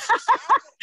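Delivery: a real burst of high-pitched laughter. Real, not acted. Host laughing at the guest's story, real high laugh.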